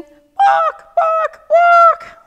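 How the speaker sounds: very high, loud imitated squawks; chicken clucking. Storyteller imitating chickens in the hut, squawking. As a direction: squawk like an alarmed hen. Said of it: "What were the chickens doing".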